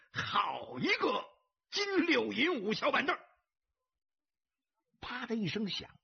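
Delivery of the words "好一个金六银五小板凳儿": spirited roar of approval; roared exclamation. A narrator's reading. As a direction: stretch the opening, then shout it out high. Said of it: "大喝道".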